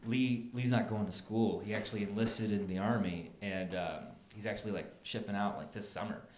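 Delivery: low, soft, subdued delivery; hushed and serious, with hesitant pauses; somber. Retold or imitated. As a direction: act out grave news quietly. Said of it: "Samantha got somber".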